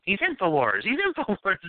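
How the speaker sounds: lightly voiced reporter, rising; imitating the reporter. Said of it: "the C B S reporter's going".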